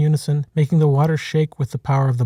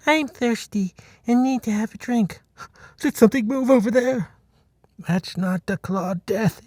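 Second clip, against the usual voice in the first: higher, anxious buffalo chorus; nervous herd muttering, higher; worried herd voices; 9 semitones higher than usual. A narrator's reading. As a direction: voice a fretting herd, pitched up.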